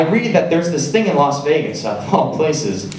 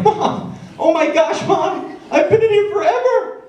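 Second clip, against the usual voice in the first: squeaky, much higher pleading cry for mom; desperate high squeal. Retold or imitated. Trapped in the bathroom, calling to mom in a much higher desperate squeal. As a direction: whine it up high like a trapped kid. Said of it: "I hear my mom like".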